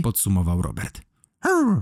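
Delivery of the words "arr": high little growled woof; small pet-dog noise; quiet dog bark. A narrator's reading. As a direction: imitate a dog's soft bark, high. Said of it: "szczeknął cicho Solar".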